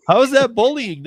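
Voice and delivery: real disbelief at the charge; indignant protest. Real, not acted. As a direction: push back, offended. Protests accusation of bullying.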